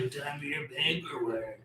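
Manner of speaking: lightly voiced grumpy office clerk; a hint of a stern receptionist; Roz voice. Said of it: "the way I based her voice off of".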